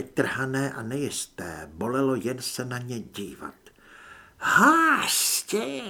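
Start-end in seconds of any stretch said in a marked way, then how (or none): 4.4-5.8 high, drawn-out creature wail; monstrous screech. A narrator's reading. Wounded parasite hunts new host, screeching.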